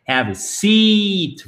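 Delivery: "seat" held long and high-pitched; long vowel. Shown as a demonstration. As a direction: stretch the ee in "seat". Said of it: "voyelle longue".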